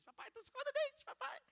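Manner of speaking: put-on very high, quick child's voice; chirpy little daughter talking to dad; little girl's voice. Preacher imitating daughter brushing teeth, high child voice. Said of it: "só de ouvir a vozinha dele".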